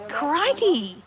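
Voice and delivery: high, excited Aussie wildlife-show exclamation; hammed-up, amazed croc-hunter cry; Steve Irwin. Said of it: "that's not Steve Irwin".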